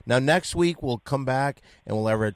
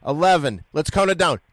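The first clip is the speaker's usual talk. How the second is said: loud, higher, calling the numbers; countdown announcer; 4 semitones higher, 6 dB louder than usual. Real, not acted. Host leads the midnight countdown, loud and excited. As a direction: count it down like an emcee. Leading New Year countdown.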